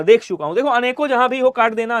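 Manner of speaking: usual speaking voice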